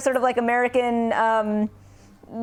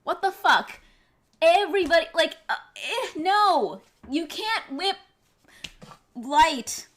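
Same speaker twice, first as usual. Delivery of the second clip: exasperated outburst; 3 semitones higher, 6 dB louder, slower than usual. Real, not acted. Ranting at a lightsaber whip.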